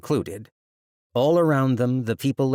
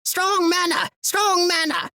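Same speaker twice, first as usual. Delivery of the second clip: shrill squawking bird, much higher; anxious bird cawing; 4 dB louder than usual. Acted. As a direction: caw it out in alarm. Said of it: "anxiously cawing".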